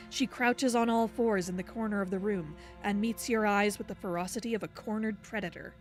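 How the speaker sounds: ordinary delivery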